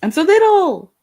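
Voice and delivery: very high squeaky little voice; tiny voice. Pretend. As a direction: squeak like a shrunken person. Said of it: "I'm tiny".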